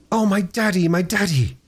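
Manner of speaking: put-on a girl overwhelmed at seeing her father; overjoyed.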